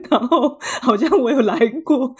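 laughing. Real, not acted. Joking she could be photoshopped there instead, laughing. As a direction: chuckle through the punchline.

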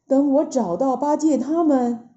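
usual speaking voice

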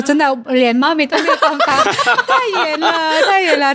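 laughing through the words; chuckling as she says it. Retold or imitated. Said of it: "我说".

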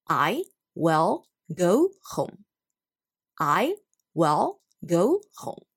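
very slow, deliberate "will" with a clear L; slow model for repeating. Shown as a demonstration. Teacher asking the class to repeat after her slowly. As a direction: say it slowly so they can copy. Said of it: "Please repeat after me".

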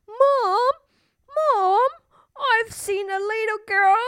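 high, breathless, urgent call; running home to tell mom; little ghost tells his mom he saw a girl; scared little ghost. A narrator's reading. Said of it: "running to mom".

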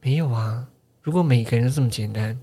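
a hint of a jaded nurse; weary nurse. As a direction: sound sick of the job. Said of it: "很厌世的护理师说".

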